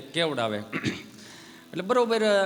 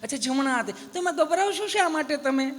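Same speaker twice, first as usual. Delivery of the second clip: higher, overly polite nurse; sweet nurse voice; 8 semitones higher than usual. Retold or imitated. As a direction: coax him like a kindly nurse. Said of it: "તમે કેટલું પ્રેમથી પૂછો છો બેન".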